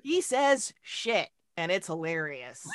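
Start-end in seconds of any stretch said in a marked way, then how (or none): 0.0-1.3 amused drawl. Real, not acted. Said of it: "amused emphasis".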